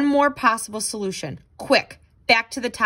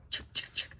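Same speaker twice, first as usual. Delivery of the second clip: sputtering machine; 14 dB quieter, much faster than usual. Acted.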